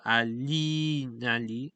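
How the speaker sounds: "Ali Nali" with the middle "li" drawn out long; lengthened E. Shown as a demonstration. Showing viewers how he lengthens the E, long ee. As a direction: hold the ee in the first "li" of "Ali Nali". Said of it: "he lengthens, uh, the sound of the E".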